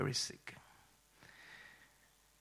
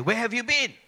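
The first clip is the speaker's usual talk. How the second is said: loud, high-pitched demand; high, hammed-up scolding yell; angry mother's shout; 9 semitones higher, 11 dB louder than usual. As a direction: imitate mom yelling an accusation. Mother confronts son after baptism.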